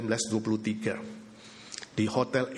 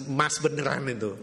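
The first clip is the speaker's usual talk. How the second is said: ordinary talk, nothing marked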